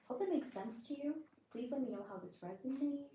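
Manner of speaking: usual speaking voice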